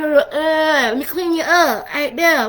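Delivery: lightly voiced mama cleaning up a child; unhurried motherly tending; mama fussing. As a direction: fuss over them slowly like a mama. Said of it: "he do that mama, you know, thing".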